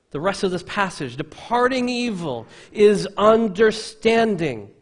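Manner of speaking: loud, high-pitched, forceful sermon delivery; full-force preaching. Real, not acted. Preacher proclaiming wisdom to the congregation, full-force preaching. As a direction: proclaim each phrase with big, ringing emphasis.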